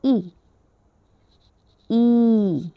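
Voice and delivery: ई held and sounded clearly; vowel shown to children; modelled vowel ई. Shown as a demonstration. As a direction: say ई twice like a teacher. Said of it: "ये है".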